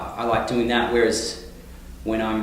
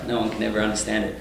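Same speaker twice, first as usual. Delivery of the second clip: usual speaking voice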